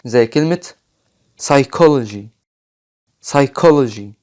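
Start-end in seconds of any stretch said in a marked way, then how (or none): 1.4-2.2 psychology with the p silent, sai-KO-lo-jee. Shown as a demonstration. Teacher demos psychology to students, silent p. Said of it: "مش بننطقه لما بيجي بعده حرف ال S". 3.2-4.1 psychology said again with no p, sai-KO-lo-jee; silent p. Shown as a demonstration. Teacher repeats psychology for the class, silent p. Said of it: "مش بننطقه لما بيجي بعده حرف ال S".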